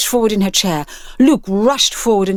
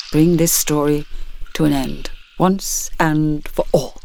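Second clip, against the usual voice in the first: a hint of an old man closing the story; solemn old man; 5 semitones lower than usual. Old man ending the monster's story: slow, low and grave. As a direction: lower and very slow, grave and final.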